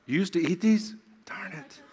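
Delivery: whispered aside; whispering. Real, not acted. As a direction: whisper it under your breath. Aside while the volunteer eats candy.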